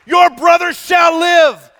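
high, slow, loud pulpit shout; thundered proclamation. Acted. Preacher voicing Jesus to grieving Martha, thundered proclamation. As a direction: thunder the promise slowly across the church.

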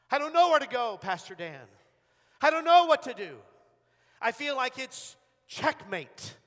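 loud, high cry of despair; acted anguish, pitched high and loud; desperate plea. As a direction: cry out at your wit's end. Voicing a desperate church member.

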